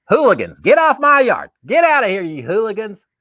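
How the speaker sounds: put-on loud, high, grumpy porch yell at the kids; grumpy old man yelling. Old man on his porch yelling at kids, loud grumpy shout. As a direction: shout them off your lawn like a cranky old man. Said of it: "I'm just gonna be yelling".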